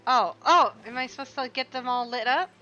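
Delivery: sudden loud burst of discovery; excited realisation. Real, not acted. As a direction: blurt it out as it clicks.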